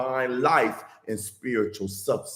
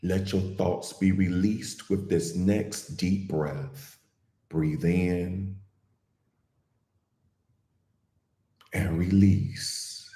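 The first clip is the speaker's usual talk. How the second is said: guided meditation; 6 semitones lower than usual. Real, not acted. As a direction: ease listeners gently into calm, unhurried and even.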